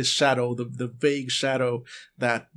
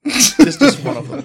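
loud laughing aside; 10 semitones higher, 12 dB louder than usual. Real, not acted. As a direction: giggle through the words. GM laughs at creepy detail.

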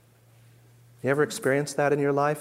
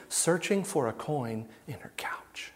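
hushed, breathy parable aside; whisper; 7 dB quieter than usual. Shown as a demonstration. Preacher to congregation, hushing for a parable, whispered. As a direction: whisper it like a secret story.

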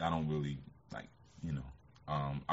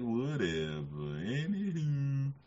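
caller impression; 6 semitones higher, much slower than usual. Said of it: "He used to talk like Wendell".

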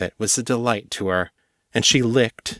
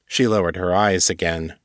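plain reading voice

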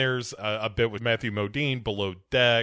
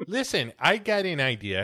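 usual speaking voice

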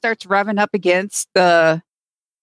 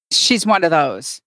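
usual speaking voice

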